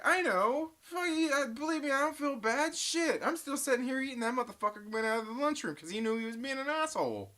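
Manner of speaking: replaying his own fired-up reply; excited retelling. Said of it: "And I was like".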